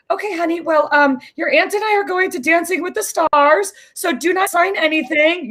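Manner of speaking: the mom voice that has just gotten nervous; worried mom voice. Retold or imitated. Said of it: "And her voice immediately changed".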